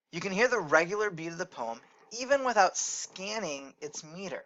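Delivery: ordinary talk, nothing marked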